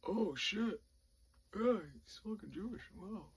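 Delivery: high, soft sergeant voice; hushed amazement. Retold or imitated. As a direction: marvel quietly to yourself. Sergeant amazed, speaking to himself.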